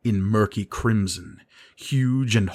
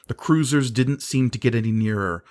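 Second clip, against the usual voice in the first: ordinary delivery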